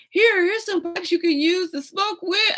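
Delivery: high, eager offer of the pipes; daughter's voice. Retold or imitated. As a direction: squeak it out like an excited kid.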